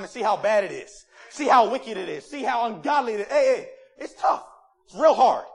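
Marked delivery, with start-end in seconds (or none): 3.3-5.5 loud preaching shout. Real, not acted. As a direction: call it out to the congregation. Preaching at full force.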